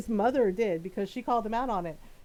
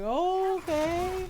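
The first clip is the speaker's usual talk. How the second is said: startled okay; 8 semitones higher than usual. Retold or imitated. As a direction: say it as if taken aback. Reacting to mom tone.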